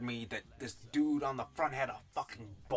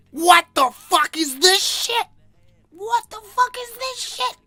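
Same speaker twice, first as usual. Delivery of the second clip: much higher, much louder, slow outraged shriek; shocked shout; 19 dB louder than usual. Real, not acted. Reviewer seeing the book thumbnail, shrieking outrage. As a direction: scream in disbelief at the cover.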